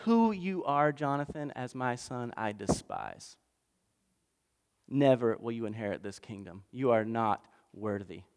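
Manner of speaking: quietly crush him with scorn; cold contempt. Acted. Saul condemning Jonathan coldly.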